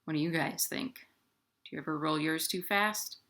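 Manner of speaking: ordinary delivery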